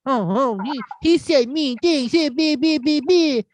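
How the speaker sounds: high whiny mock complaint; mocking whiny liberal. Mocking offended liberals, high and whiny. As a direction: sputter like an offended snowflake. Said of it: "you had to deal with mean tweets".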